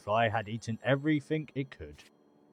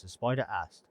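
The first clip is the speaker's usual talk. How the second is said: ordinary talk, nothing marked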